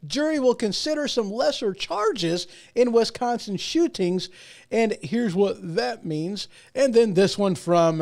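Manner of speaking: ordinary talk, nothing marked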